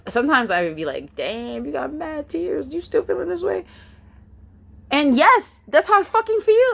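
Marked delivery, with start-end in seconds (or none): 1.1-3.6 high, soft voice climbing higher; small, high-pitched incredulous voice; mock inner questioning. Voicing her doubting inner remark. 4.9-6.7 loud, very high-pitched comeback; acted indignant outburst, high and loud; defiant retort.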